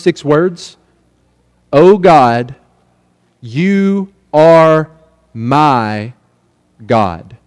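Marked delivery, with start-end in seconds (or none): 1.7-7.4 "my" set apart by pauses, low and drawn out; slow verse, stopping before and after "my"; emphatic pauses. Shown as a demonstration. Preacher showing the congregation the psalm's first six words, emphatic pauses.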